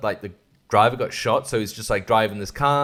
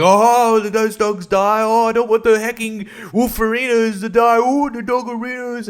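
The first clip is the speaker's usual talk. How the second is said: mock whiny producer; 11 semitones higher, 6 dB louder than usual. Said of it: "executive producers was like".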